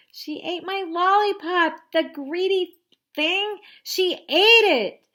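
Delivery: high, loud, wailing complaint; whiny little sister. Acted. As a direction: wail it like a wronged little kid.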